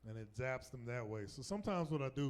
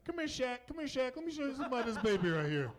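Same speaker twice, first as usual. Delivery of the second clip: high, excited mock parent calling out; eager calling over; 11 semitones higher, 5 dB louder, faster than usual. Proud parent calling the nurse over to see the baby, eager and high. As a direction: call over eagerly in a much higher voice.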